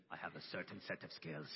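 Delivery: low movie tough-guy threat; Liam Neeson. Acted. Father jokingly playing the avenger from Taken, bad impression. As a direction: drop into a bad action-hero impression. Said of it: "freaking out to Liam Neeson".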